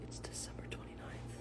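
whispered, no voice at all. Acted. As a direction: whisper it like a secret. Sudden whispering of the announcement.

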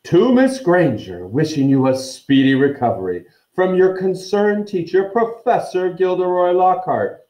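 reading aloud. A narrator's reading. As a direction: declaim the grand signature with mock ceremony. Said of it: "read it aloud".

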